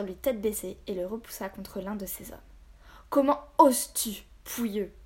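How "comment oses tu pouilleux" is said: loud, indignant storybook captain; outraged. A narrator's reading. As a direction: demand it in acted outrage, easing on the insult. Captain outraged at being attacked.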